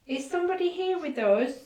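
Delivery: loud, high call into the dark. Real, not acted. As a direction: call out to an unseen presence. Calling out to a ghost.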